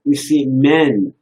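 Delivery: ordinary delivery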